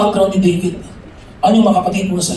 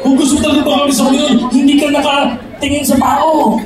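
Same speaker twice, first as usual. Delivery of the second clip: impassioned preacher, rising higher as it goes; fervent preaching; 6 semitones higher than usual. Real, not acted. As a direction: preach it with full fervor. Preaches at full force.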